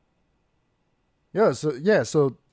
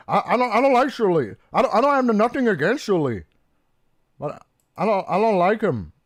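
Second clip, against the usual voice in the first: quick, higher put-on voice of Chad; Chad impression; 5 semitones higher, 4 dB louder, faster than usual. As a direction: do Chad, fast and high. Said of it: "That was my, my impression".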